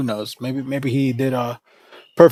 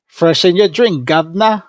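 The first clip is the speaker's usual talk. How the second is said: cartoonish Simpsons-style British accent, louder; hammed-up British caricature voice; mock British accent; 4 semitones higher, 5 dB louder than usual. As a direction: lampoon a stuffy Brit the way a cartoon would. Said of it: "what I think when I think British people".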